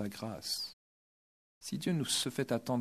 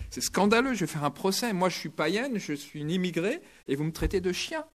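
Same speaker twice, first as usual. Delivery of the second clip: mock outrage; 7 semitones higher, 4 dB louder than usual. Preacher mimics woman's indignation.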